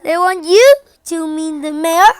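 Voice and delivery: loud, incredulous question; disbelieving. Acted. Sister can't believe Bertie will meet the mayor, loud disbelief.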